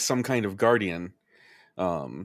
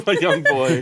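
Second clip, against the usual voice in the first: real laugh through the words, higher and louder; 7 semitones higher, 6 dB louder than usual. Real, not acted. Podcast host starting the character introduction, laughing. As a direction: crack up while speaking.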